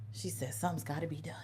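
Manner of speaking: soft whisper of the boss's words; whispered quote. Retold or imitated. Quoting her regional manager's reply in a whisper. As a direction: hush it like a secret order.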